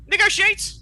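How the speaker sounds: high, loud, incredulous snap; outraged villain. Acted. Villain scoffs at negotiating with the kindergarten, loud and high. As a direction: spit the word back in disbelief.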